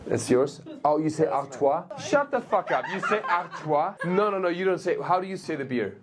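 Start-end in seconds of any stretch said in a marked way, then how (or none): none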